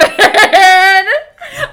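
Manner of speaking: loud, high hyena cackle; hyena laugh. Real, not acted. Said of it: "I was laughing like a hyena".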